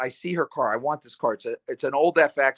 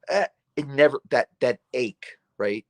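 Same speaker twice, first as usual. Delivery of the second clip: normal talking voice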